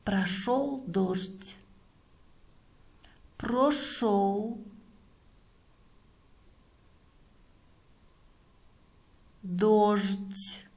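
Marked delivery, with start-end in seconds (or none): 3.4-10.7 word by word with long pauses; dictating. Shown as a demonstration. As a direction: dictate slowly, one word at a time. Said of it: "Следующее предложение". (диктует по словам)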